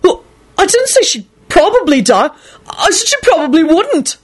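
high stubborn insistence; obstinate. Acted. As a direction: dig in stubbornly. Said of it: "Neville responded obstinately".

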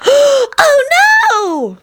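put-on very high, wailing child voice; horrified little son. Son hearing the witch will be cut, horrified wail. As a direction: wail like a dismayed small boy.

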